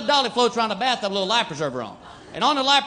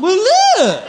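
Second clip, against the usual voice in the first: delighted dimwit; 6 semitones higher than usual. Retold or imitated. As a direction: gush over the find like a happy fool. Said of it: "somebody opened that box and went".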